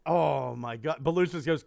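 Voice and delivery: usual speaking voice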